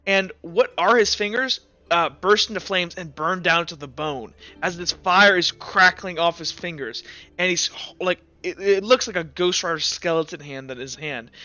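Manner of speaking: ordinary talk, nothing marked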